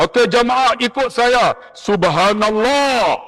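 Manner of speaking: rally the group, drawing out Subhanallah; calling out to group. Retold or imitated. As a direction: ustaz calling his pilgrims to follow him. Ustaz calls out to pilgrims.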